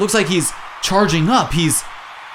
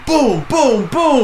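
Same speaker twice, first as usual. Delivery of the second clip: hyped commentator; 8 semitones higher, slower than usual. Acted. Commentator calling the hits.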